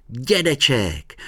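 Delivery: high, drawn-out happy exclamation; joyful cry. A narrator's reading. Honzík hears grandpa will meet him, joyful cry. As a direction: call it out with delight. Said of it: "vykřikl Honzík a zaradoval se".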